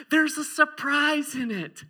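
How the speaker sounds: put-on a kid savoring his secret; sly little boy. Son teasing dad about the cup, high and slow. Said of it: "He goes".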